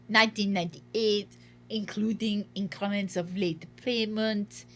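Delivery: reading a letter in a mock French accent; lightly put-on French accent, unhurried; hammed-up French official reading a letter. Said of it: "My French accent is".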